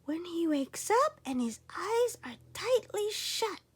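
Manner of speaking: put-on high, tearful boy fretting; a small boy near tears weighing his fear; boy afraid the eagle will eat him, high and teary; worried boy.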